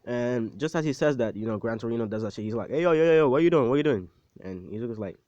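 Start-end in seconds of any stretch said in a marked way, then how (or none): 2.7-4.1 loud, high mock shout of alarm; yelling at him. Old mentor yelling at a passed-out student, loud mock alarm. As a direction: yell to wake a passed-out kid. Gran Torino yelling at unconscious Izuku.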